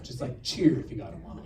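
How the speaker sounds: usual speaking voice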